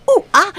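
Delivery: monkey chatter cry; monkey call. Acted. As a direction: hoot like an excited monkey. Monkey hears the alarm.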